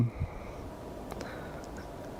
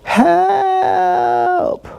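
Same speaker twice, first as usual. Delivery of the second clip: high, far-off mock cries for help; thin high wail of a woman at her window; imitating a yelling woman. Neighbor imitates woman yelling from window, high mock wail. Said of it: "this woman yelling out her window".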